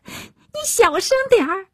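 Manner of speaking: high, strained urgent plea; really frantic. A narrator's reading. Daughter desperate to hush her shouting mother, frantic. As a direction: urge it in a very high, tight voice. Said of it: "杨小梅真急了".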